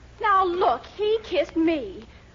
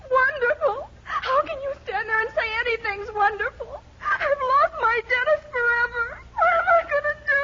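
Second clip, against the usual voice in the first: higher and higher through tears, rushing the end; tearful wail; 7 semitones higher than usual. Acted. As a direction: sob out her heartbreak to mother. Girl sobs over lost fiancé.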